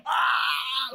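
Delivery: loud, high-pitched mock cry of agony; agonized groan. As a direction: cry out as if dying of suspense. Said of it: "we're just dying".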